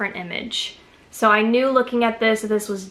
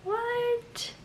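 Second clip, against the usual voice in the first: surprised squeal; 14 semitones higher, 9 dB quieter than usual. Real, not acted. Surprised at inspection mirror.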